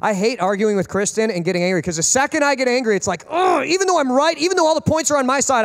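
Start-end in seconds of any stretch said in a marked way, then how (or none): 3.3-5.7 high-pitched exasperated lament; hammed-up, high complaint; mock frustration. Acting out frustration at losing argument.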